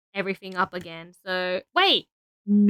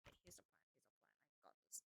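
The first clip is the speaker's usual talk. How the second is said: whispered to herself, barely audible; a private whispered pep talk; 7 semitones lower, 44 dB quieter, much faster than usual. Real, not acted. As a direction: breathe the plan under your breath. Muttering plan to herself.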